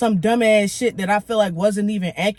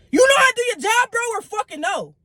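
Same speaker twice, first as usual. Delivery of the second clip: exasperated outburst; 12 semitones higher, 3 dB louder than usual. Retold or imitated. Snapping at the ticket agent.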